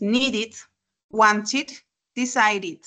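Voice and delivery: needed, wanted, decided each ending in a separate "id". Shown as a demonstration. Teacher repeats needed, wanted, decided, ID endings. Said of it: "la pronunciación va a ser con I D".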